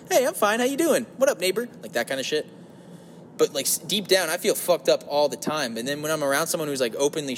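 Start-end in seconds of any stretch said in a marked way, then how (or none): 0.1-1.7 much higher, hammed-up breezy greeting; fake cheerfulness. Hiding problems from neighbors, fake cheerful. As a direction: put on a fake happy front. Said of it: "put on this fake".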